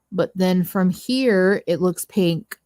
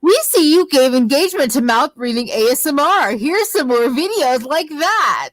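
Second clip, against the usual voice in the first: robotic YouTube voice; 7 semitones higher, 3 dB louder than usual. Retold or imitated. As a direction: read it like a smug algorithm. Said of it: "YouTube being like".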